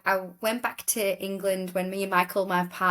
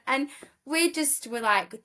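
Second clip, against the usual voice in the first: ordinary talk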